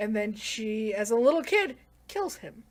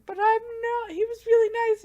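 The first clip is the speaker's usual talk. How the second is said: much higher, louder acted voice; mock protest; 13 semitones higher, 6 dB louder than usual. Girl defending a guy to Yuki, high mock protest.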